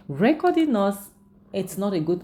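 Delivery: high, loud objection from the wife. Retold or imitated. Angel protests to Kizito about recording them, loud and high. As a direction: push back sharply on being recorded. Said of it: "She's like".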